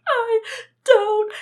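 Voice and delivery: very high, loud, broken-up wail; hammed-up crying, last word drawn out; imitated bawling. Imitating self sobbing to mom after medication, hammed-up bawl. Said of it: "I remember crying".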